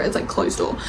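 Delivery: whispered. Real, not acted. Aside on spice.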